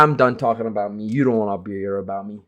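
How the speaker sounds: usual speaking voice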